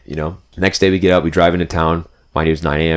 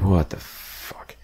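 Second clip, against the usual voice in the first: slow disbelieving whisper; 10 dB quieter, much slower than usual. Retold or imitated. Drain plug stripped, disbelief.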